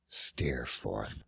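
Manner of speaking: breathless whisper of the name. A narrator's reading. Whispered name of lost friend.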